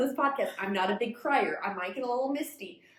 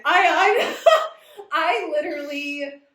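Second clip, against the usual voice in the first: louder, higher, laughing on the edge of crying; laughing into tears; 7 semitones higher, 9 dB louder, slower than usual. Real, not acted. Host breaking up while recalling the sad death. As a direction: laugh that breaks toward a sob. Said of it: "I'm gonna start crying".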